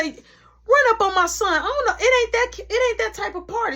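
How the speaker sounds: high, loud scolding warning; angry mother. Retold or imitated. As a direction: put the attacker in his place. Mother confronting attackers.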